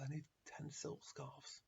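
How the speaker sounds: soft, slow, firm repeat; quiet insistence. Acted. Rabbi calmly repeats his demand.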